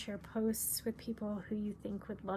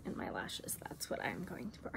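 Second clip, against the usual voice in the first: soft lower unvoiced aside; whispered; 9 semitones lower, 8 dB quieter than usual. Real, not acted. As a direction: drop to a whisper.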